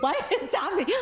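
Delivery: high, laughing bewilderment; incredulous high-pitched question through laughter; baffled disbelief. Retold or imitated. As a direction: cry it high, half laughing, totally baffled. Retelling her confusion about pandemic.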